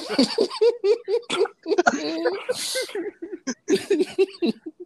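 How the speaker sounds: high helpless laughing fit; can't hold back the laugh; loud burst of laughter. Real, not acted. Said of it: "I'm trying not to laugh".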